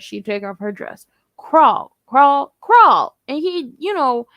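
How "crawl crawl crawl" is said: loud mock order, repeated; said like a question. Man ordering her about, said like a question. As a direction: imitate his unsure command, loudly. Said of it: "he said it like it was a question".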